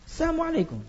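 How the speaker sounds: high quick "Assalamualaikum", lam lost; quick swallowed greeting. Shown as a demonstration. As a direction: rush "Assalamualaikum" so the lam vanishes. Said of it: "kalau cepat itu nggak terdengar".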